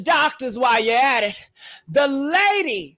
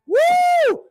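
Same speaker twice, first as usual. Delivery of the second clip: high whoop of joy; excited whoop; 4 dB louder than usual. Real, not acted. Host hyping her audience about Wendy news, high whoop. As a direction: let out a loud celebratory woo.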